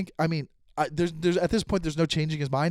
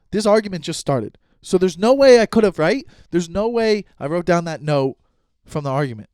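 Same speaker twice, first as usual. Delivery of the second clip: louder, insistent self-defence; retold heated protest; 2 semitones higher, 7 dB louder than usual. Defending his notes to the accuser, loud and insistent. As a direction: hammer the point as if still arguing.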